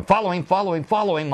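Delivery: urgent calling out. Retold or imitated. Excited boss urging cameramen.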